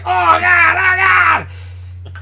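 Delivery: high strained mock cry of disgust; pained high-pitched "oh God"; choking groan. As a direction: act out gagging on stuck dip. Choking on dip, retold reaction.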